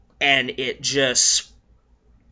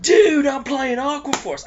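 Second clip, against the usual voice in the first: hammed-up Australian surfer, much higher; Australian surfer accent; 12 semitones higher, 5 dB louder than usual. Surfer guy at the tournament, mock Australian accent. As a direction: toss it off like a laid-back Australian surfer dude. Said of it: "with an Australian accent".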